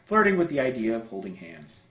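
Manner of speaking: ordinary delivery, nothing marked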